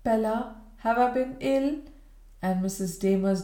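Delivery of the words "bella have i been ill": higher, lucid and bewildered; waking invalid asking her cousin; changed voice. A narrator's reading. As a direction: wake from fever and ask, confused. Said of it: "a changed voice sounding with the question".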